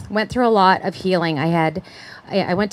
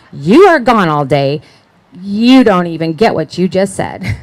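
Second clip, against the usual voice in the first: indignant retort; 9 dB louder than usual. Retold or imitated. Retorting to husband about baby.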